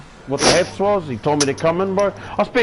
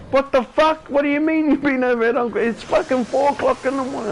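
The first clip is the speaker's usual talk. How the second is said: higher, fast, exasperated telling-off; angry wife; 8 semitones higher than usual. Retold or imitated. Wife telling off husband stumbling home at four, high and fast. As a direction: scold him like a furious partner. Wife scolding him at 4am.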